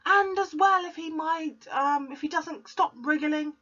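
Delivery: high scornful retort; snorting. Acted. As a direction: sneer it back. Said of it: "snorted the Trunchbull".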